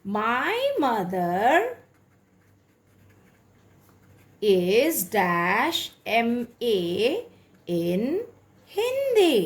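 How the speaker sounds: very slow, word by word; slow dictation. Shown as a demonstration. Teacher dictating an example sentence to students, very slowly. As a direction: spell it out for them to write down.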